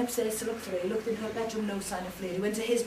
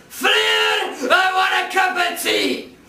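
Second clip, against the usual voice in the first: loud, slow bellow; demanding giant shout; 8 semitones higher, 10 dB louder, slower than usual. Acted. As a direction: roar the demand up the stairs.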